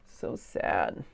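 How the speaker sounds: soft, whispered, low pity; whispered sadness. Acted. Reading to a child, whispering pity for Binkle. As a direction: breathe it out in sorrow.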